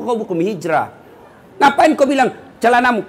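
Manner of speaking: normal talking voice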